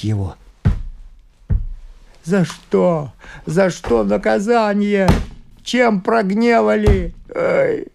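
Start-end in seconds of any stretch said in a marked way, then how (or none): 2.2-7.9 high, drawn-out lament; anguished wail. A narrator's reading. Father asks heaven why he is punished, wailing. Father wails over injured daughter.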